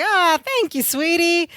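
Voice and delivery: a hint of a flattered diner owner cooing; sassy flattered waitress. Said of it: "she's like".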